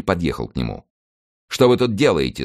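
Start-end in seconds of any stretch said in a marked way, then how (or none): 1.5-2.4 lightly haughty, higher-pitched demand. A narrator's reading. Leader questioning a stranger on the road, haughty. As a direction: question as one used to giving orders. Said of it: "спросил он надменным голосом, привыкшим повелевать".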